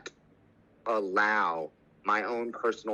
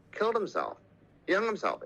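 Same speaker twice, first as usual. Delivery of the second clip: higher-pitched, pained disbelief; real distress; 8 semitones higher, faster than usual. Real, not acted. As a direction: let the pitch jump up, then fall away in pain. Recalling a shocking suicide, emotional.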